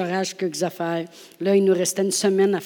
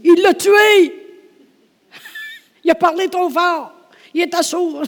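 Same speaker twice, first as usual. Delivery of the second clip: much higher, louder alarm; panicked crowd; 10 semitones higher, 8 dB louder than usual. Acted. The crowd crying that Jesus killed the boy. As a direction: cry out in shocked alarm.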